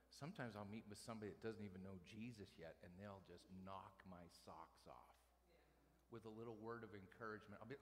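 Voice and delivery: ordinary talk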